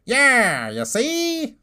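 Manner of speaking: put-on old-timey gangster "you see?"; high sneering cartoon villain; cartoon bad guy. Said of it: "I'm like".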